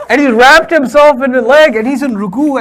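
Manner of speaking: ordinary talk, nothing marked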